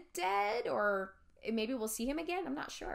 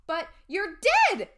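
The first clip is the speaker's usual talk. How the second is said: high, very loud shocked cry; shocked blurt; 9 semitones higher, 12 dB louder than usual. Acted. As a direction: blurt it in disbelief. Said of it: "I blurted out".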